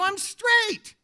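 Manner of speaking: very high, insistent denial; mock-panicked high insistence; emphatic protest. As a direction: protest harder, voice jumping right up. Repeats protest more emphatically.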